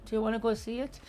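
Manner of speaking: soft, hesitant meek asking; timid, apologetic little request. Shown as a demonstration. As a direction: ask meekly, tiptoeing into it. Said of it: "don't be all meek".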